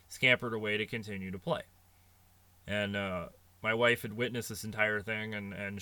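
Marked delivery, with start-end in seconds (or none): none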